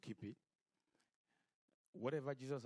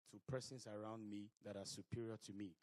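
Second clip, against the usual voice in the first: ordinary delivery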